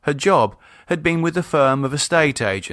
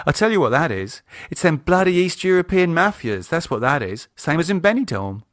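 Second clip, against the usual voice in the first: a gossipy wife, higher and emphatic; Michelle's voice; 6 semitones higher than usual. A narrator's reading. Wife tells husband her theory, lightly voiced. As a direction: sure she knows who's to blame.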